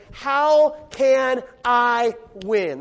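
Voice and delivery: each word of "How can I win" drawn out slowly; emphatic question. Acted. Believer failing in many areas asks for help, slow emphatic question. Voices a struggling believer's plea.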